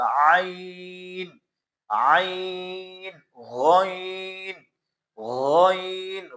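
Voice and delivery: عین from mid-throat then heavy غین, each held slowly; ain and ghain demo. Shown as a demonstration. Teacher modelling throat letters for students. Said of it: "غین کو موٹا پڑھنا ہے".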